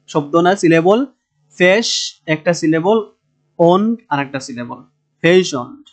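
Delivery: ordinary talk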